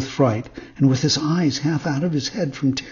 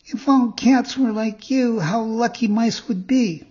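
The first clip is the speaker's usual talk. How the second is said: high puppet voice, not really whispered; pitched well up, a touch of being moved; tearful puppet; 9 semitones higher, 3 dB louder than usual. A narrator's reading. Puppet near tears praises the cat, high puppet voice. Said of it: "whispered in the cat's ear".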